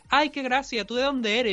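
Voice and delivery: high, sing-song imitation of a condescending teacher; retelling how the teacher mocked his Andalusian accent, imitating her in a high, patronising voice; mocking the teacher. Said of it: "la hija de puta que había de profesora decir".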